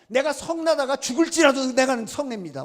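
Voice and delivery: loud mock defiance; acted obstinate outburst; defiant retort. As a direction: insist stubbornly, talking back to God. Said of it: "이놈이 뭐라고 말합니까".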